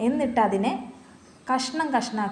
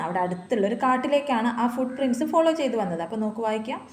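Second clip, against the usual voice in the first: usual speaking voice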